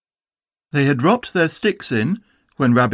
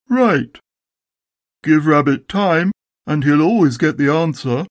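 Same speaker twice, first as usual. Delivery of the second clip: lightly voiced Eeyore, pitched higher; Eeyore, sardonic; 5 semitones higher than usual. A narrator's reading. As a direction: deliver dry sarcasm as a put-upon Eeyore.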